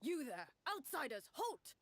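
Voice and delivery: commanding shout. Acted. Game master as guard captain ordering outsiders to stop, commanding shout. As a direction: stop the strangers in their tracks. Captain halts party in tale.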